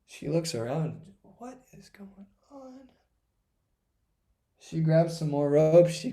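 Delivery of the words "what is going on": high, unvoiced whisper; baffled whisper. Mother finding the rope too short, baffled whisper. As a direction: whisper it in bewilderment.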